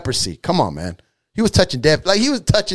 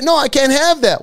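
high, emphatic acted refusal; punchy, high-pitched no; firm refusal; 7 semitones higher than usual. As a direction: refuse temptation point-blank, pitched up. Acted answer to cigarette offer.

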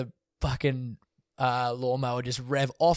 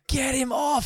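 higher, urgent call to get him off the plane; a higher-pitched retelling of the urgent shout; retold urgent order; 12 semitones higher, slower than usual.

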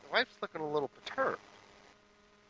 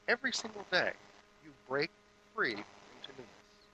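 ordinary delivery

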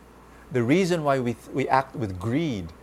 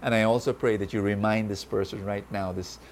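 prayer; 4 semitones lower than usual. Acted.

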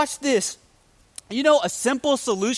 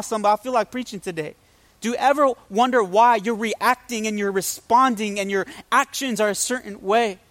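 ordinary talk, nothing marked

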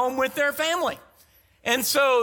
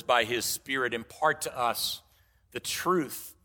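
prayer; 8 semitones lower than usual. Acted. Closing prayer over scripture.

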